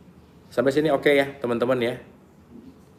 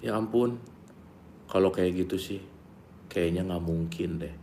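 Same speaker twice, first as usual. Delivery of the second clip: resigned murmur; 4 semitones lower, 10 dB quieter than usual. Retold or imitated. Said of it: "Contoh nih, ini yang kebiasaan".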